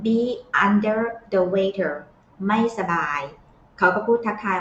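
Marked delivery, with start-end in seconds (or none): 0.1-1.9 very slow, syllable by syllable; slow idiom model. Shown as a demonstration. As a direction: stretch the idiom out for learners. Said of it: "teacher models the idiom".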